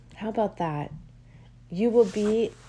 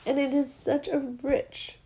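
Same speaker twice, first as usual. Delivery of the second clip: getting choked up; 6 semitones higher than usual. Real, not acted. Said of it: "God, I'm gonna get choked up".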